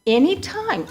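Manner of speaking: "any time" said high and pressed, "any" lifted well above the rest; urging them to call any time, "any" pushed high; emphatic repeat. Real, not acted. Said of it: "I need at any time".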